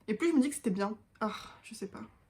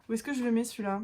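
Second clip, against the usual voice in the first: normal talking voice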